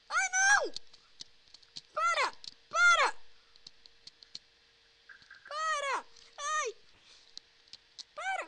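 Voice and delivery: high, loud panicked cries; panicked yelling. Real, not acted. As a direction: scream for it to stop. Being attacked in game.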